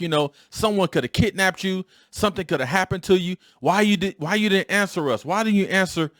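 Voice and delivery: high, loud-ish father's scolding; a dad going off at his son in the car; retold angry scolding. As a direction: scold the boy like a worried dad. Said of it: "He was like".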